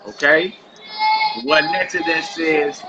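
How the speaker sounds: ordinary talk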